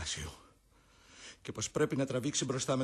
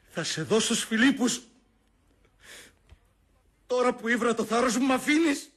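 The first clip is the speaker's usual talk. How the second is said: much higher, much louder challenge; defiant shout; 9 semitones higher, 10 dB louder than usual. Acted. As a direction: hurl the words after the vanishing spirit. Brutus defies the ghost. (ο Βρούτος φωνάζει προκλητικά στο φάντασμα)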